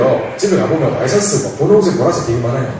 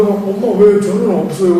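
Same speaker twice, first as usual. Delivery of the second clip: put-on slow, higher plaintive little boy asking his mom; whiny child voice; 4 semitones higher, slower than usual. Left-out son asking his mother why, whining. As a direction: ask like a left-out kid, drawn out. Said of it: "이렇게 물으면".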